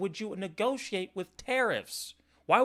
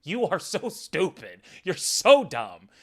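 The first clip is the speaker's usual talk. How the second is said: slow contemptuous insult; 5 dB louder than usual. Real, not acted. Insulting the clip speaker.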